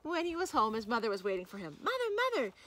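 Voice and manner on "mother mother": put-on very high, calling boy; excited cry. A narrator's reading. Boy calling to his mother, high excited cry. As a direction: call out like a child bursting with news. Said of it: "he cried".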